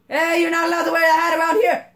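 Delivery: high, loud, fast guard voice; a hammed-up busybody telling him off; fast mock scolding. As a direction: rattle it off like a bossy guard. Voicing an NPC.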